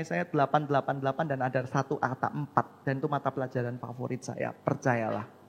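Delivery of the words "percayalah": ordinary talk, nothing marked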